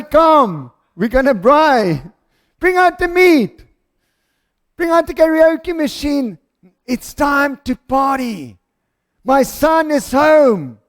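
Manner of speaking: rally the guests with drawn-out, joyful cries; jubilant summons. Acted. Father calling guests to braai as his son is home, jubilant. Father calls everyone to party.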